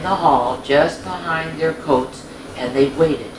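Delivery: bright, lilting storybook narration; reading to children. A narrator's reading. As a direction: read it like a bedtime story for little ones.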